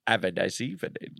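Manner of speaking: put-on low, soft, laughing Greek accent; alluring Greek accent. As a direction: breathe it in a seductive Greek accent. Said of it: "That's alluring Greek".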